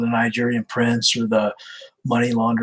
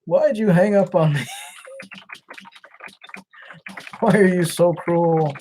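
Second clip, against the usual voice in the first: high, hammed-up lament; mock wail; 6 semitones higher than usual. Caller laments to scammer who hung up, mock wail. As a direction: wail it like a jilted lover.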